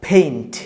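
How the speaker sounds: "ফেইন্ট" with a puff of air on the p; aspirated P. Shown as a demonstration. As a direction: drill "ফেইন্ট" with breathy p and falling tone. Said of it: "ফয়ের মতো উচ্চারণ হবে". ("ফেইন্ট" ফয়ের মতো)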